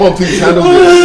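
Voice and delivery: high shouted plea; desperate shout. Acted. Son begs mother for help.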